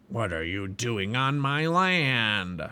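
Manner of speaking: slow, rough-voiced giant challenging intruders. Giant Despair waking the lost pilgrims, slow rough giant voice. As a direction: drag it out like a menacing giant. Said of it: "a loud, rough voice".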